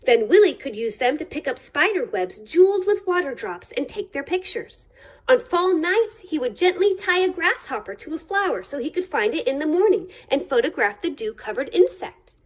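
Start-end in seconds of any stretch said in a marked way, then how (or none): none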